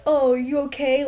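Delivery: ordinary talk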